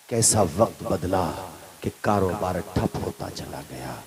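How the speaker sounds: soft, sombre narration of a fall; hushed storytelling. Acted. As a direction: drop to a low, soft murmur. Hushed telling of the son's downfall.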